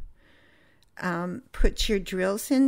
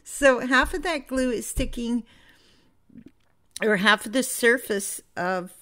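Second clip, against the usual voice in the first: higher and louder, hiccups in the words; hiccuping; 4 semitones higher, 4 dB louder than usual. Real, not acted. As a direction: push on through the hiccups. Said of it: "I have the hiccups again".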